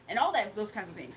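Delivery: usual speaking voice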